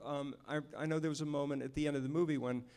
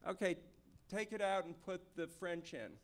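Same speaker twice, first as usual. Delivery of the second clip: imitating director; 4 semitones higher, 7 dB quieter than usual. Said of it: "and he'd go like".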